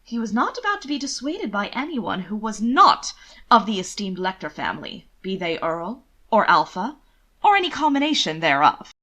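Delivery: usual speaking voice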